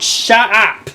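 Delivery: loud high snap of annoyance; sharp high shout; playful shout. Real, not acted. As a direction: yell it at the noise outside. Outburst at a noise outside.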